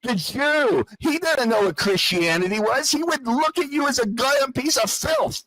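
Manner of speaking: furious rising tirade; angry ranting. Real, not acted. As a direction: spit the contempt out, rising. Heated argument over religion.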